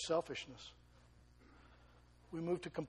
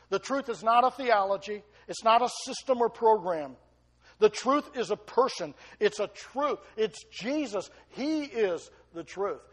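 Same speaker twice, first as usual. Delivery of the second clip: louder, higher building sermon voice; preaching at full force; 4 semitones higher, 9 dB louder than usual. Acted. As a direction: preach it hard and rising. Preaching climax.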